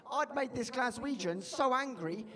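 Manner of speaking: normal talking voice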